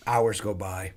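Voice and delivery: ordinary delivery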